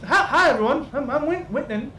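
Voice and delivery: high, nervous little man; jittery high-voiced alchemist introducing himself; timid high voice. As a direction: squeak out a shy hello.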